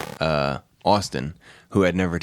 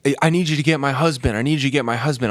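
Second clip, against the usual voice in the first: higher-pitched urgent imitation; pleading old woman; 6 semitones higher, 3 dB louder, faster than usual. Injured woman begging a stranger to get her husband, urgent. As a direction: imitate her insistent plea, pitch raised.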